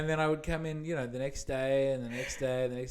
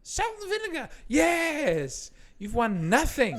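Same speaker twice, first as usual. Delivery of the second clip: mock caller; 11 dB louder, slower than usual. Caller then host.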